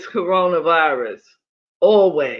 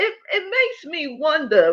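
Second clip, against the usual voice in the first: laughing; 7 semitones higher, 3 dB quieter than usual. Real, not acted. Interviewer reacting, laughing.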